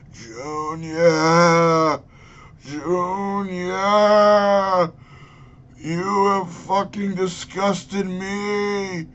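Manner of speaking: put-on a zombie father scolding his son in a terrible Massachusetts Kennedy accent; deliberately awful Massachusetts Kennedy impression, drawn out and high; bad Massachusetts Kennedy accent. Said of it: "worst M-, Massachusetts Kennedy accent I think I've ever heard".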